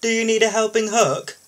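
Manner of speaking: high, loud, keen offer of help; a keen crane offering a hand; eager offer. Acted.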